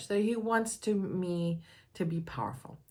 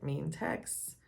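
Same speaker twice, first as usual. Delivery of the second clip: usual speaking voice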